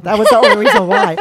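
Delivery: high, loud, laughing outburst. Real, not acted. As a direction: crack up while protesting. Laughing over a teasing remark.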